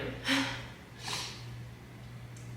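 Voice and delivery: short breathy laugh, almost silent. Real, not acted. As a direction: let a soft laugh escape through the breath. Breathless laugh after sad confession.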